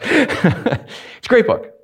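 slow, laughing through "great book". Real, not acted. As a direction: let the laugh carry it. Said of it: "You know it inside and out".

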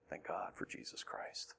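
slow, barely audible whisper; whispered thanks. Real, not acted. Preacher repeating thanks to God, whispering.

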